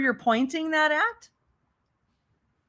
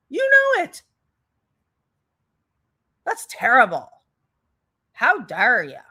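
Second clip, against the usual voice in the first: mock accusation. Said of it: "mocking her logic".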